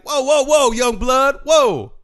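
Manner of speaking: put-on loud, high calm-down call to kids; old man calling out. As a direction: hold up the brawl like an old-school elder. Old man breaking up a fight.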